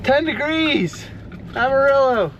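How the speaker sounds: high incredulous exclaim; disbelief. Real, not acted. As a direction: marvel at the brutal cold. Reacting to the cold.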